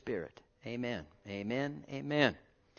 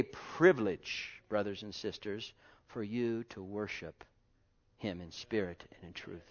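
tender hush; 7 semitones lower, 9 dB quieter than usual. Acted. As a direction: draw them in quietly.

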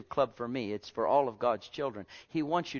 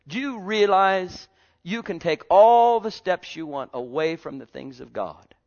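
normal talking voice

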